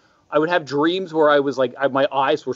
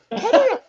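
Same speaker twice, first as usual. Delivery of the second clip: ghostly wail; 3 dB louder than usual. Retold or imitated. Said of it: "Adam Sandler doing a ghost impression".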